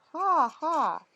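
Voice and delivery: high loud cackling bird laugh. A narrator's reading. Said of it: "Then he changed into a bird".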